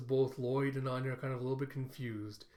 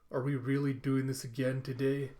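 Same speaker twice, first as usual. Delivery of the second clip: ordinary talk, nothing marked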